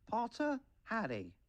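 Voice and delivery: high-pitched calling out of a name; surname pitched high, first name dropping; roll call. A narrator's reading. Teacher calling the roll of names.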